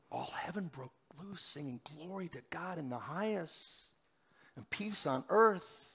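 hushed, breathless wonder at heaven singing; awed whisper. Acted. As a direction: drop to a reverent whisper. Awed retelling of heavenly song.

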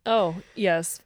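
ordinary talk, nothing marked

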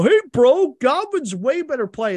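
high, loud mock bro voice; mimicking the eliminated friend's smug advice, mock bro voice; mocking know-it-all bro. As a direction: parody the smug buddy chiming in.

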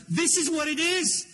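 high-pitched retold exclamation; reenacted eager outburst to wife; excited insistence. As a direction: burst out high with conviction. Urging his wife about the song.

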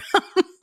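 short, high, loud laugh; quick squeal of laughter; burst of laughter. Real, not acted. Laughs after own retold curse.

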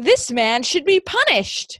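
loud, high, drawn-out mock indignation; mock outrage. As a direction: declare it slowly with hammed-up outrage. Mock outrage at movie villain.

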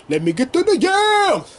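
high, stretched cheering call; excited cheer. Pretend. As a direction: hype her up like a fan in the stands. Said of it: "repeats the cheer".

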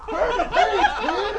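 high, loud page-turn yell. Retold or imitated. Imitating the guy on a kids' tape, yelling high and loud. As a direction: bellow it as a sudden startling command. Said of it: "when you hear this guy yell".

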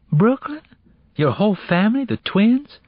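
high-pitched startled questions; surprised. Acted. Girl questioning her friend about moving to Brooklyn, surprised. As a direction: react in disbelief, voice jumping up.

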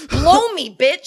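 high, very loud outburst; mock angry shout. Host acting outburst on set, mock angry shout. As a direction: yell it as a hammed-up insult.

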